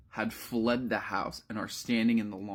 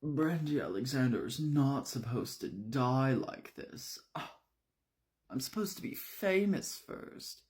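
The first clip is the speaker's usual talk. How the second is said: theatrical dying lament, climbing higher; hammed-up woe with drawn-out endings; self-pitying drama queen; 4 semitones higher than usual. Brandy talking to herself as she dies, melodramatic self-pity. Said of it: "having a full third person conversation to herself".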